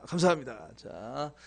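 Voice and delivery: ordinary talk, nothing marked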